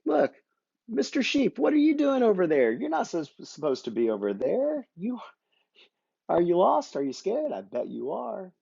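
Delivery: a cartoonish shepherd who has just found his lamb; high puppet shepherd voice. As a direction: Chewbacca puppet calls out high to the lost sheep. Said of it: "he goes".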